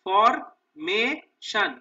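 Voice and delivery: "Formation" slow and loud, in three pieces; split into syllables. Shown as a demonstration. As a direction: pause between ফর, মে and শন in "formation". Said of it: "তিনটে সিলেবলে ভাগ করে নেবো". ("Formation" তিন ভাগে কেটে, জোরে ও ধীরে)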